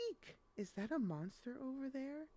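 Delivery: high startled cry of fright; scared shriek. Acted. Crane spots a monster in the dark, high startled fright. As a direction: yelp it out like you just saw a monster.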